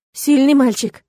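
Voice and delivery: mother amused by her son; wry, lively exclamation; amused snort. A narrator's reading. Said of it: "хмыкнула она".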